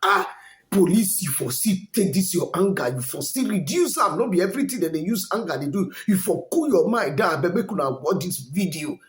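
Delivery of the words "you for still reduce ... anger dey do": ordinary talk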